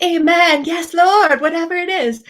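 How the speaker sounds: eager amen. Pretend. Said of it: "you would think I would be like".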